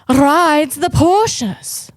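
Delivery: playful, very slow and lifted in pitch; mock grandeur. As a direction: stretch it out slowly with mock grandeur. Skit mocking a fancy Porsche rider.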